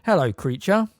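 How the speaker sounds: very loudly. Acted. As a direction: call out loud on purpose to catch him muttering. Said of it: "said Fred very loudly".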